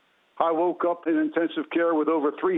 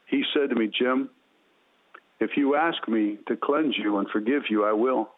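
low, tender, reassuring voice; loving, compassionate; 6 semitones lower than usual. Retold or imitated. Jesus answering a desperate man, low and compassionate. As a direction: speak low and gently, with deep compassion. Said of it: "with loving, kind, affectionate, compassionate words".

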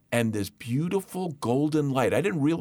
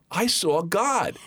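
put-on high, louder, slow child announcing; awed little boy; 7 semitones higher, 4 dB louder, slower than usual. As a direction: say it slowly with childlike awe. Child tells mother news.

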